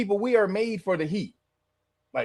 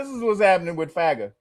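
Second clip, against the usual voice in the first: ordinary talk, nothing marked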